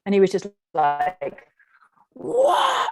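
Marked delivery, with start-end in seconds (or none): 2.1-2.9 big high yell, full voice out over the mountains; retold roar; mighty roar. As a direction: let out a huge mountain-top roar. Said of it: "And he, like, roared".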